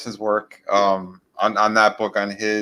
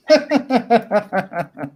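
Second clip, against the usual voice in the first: laughing; 10 semitones higher than usual. Real, not acted. Teasing friend, laughing.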